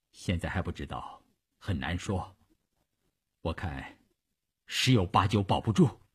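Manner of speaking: low, very soft, hesitant answer; grim doctor's prognosis. A narrator's reading. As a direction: break bad news quietly, with pauses. (低声沉重地回答)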